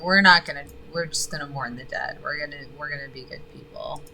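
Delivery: normal talking voice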